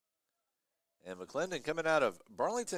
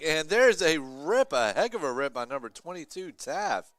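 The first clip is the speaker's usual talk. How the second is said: excited shot call; 5 semitones higher, 9 dB louder than usual. Real, not acted. Commentator calling a powerful soccer shot, loud excited call. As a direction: belt out the shot like it nearly went in.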